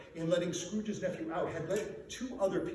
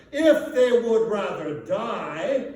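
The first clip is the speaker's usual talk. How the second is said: loud, slow, higher cold scorn; harsh retort; 4 semitones higher, 11 dB louder, much slower than usual. A narrator's reading. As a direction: let it land cruelly.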